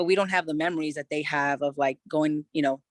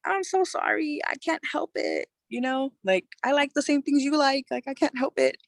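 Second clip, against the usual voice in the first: high, tearful pleading apology; retold crying; 10 semitones higher than usual. Daughter apologizes to her crying dad, high and tearful. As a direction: relive the sobbing apology, pitched way up. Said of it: "I started crying".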